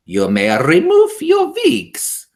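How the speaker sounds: high, loud, slow witch snarl; Grand High Witch snarling an order; snarled command. A narrator's reading. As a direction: snarl it with menace. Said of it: "snarled the Grand High Witch".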